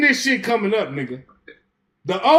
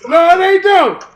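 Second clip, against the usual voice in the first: shouted objection; 6 semitones higher, 8 dB louder than usual. Real, not acted. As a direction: shout them down to get the floor. Shouting down another panelist.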